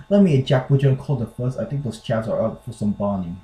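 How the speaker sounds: amateur attempt at British English; hammed-up British slang in a shaky accent attempt; bad British accent. Shown as a demonstration. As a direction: read it in a badly faked British accent. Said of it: "I really can't do a British accent".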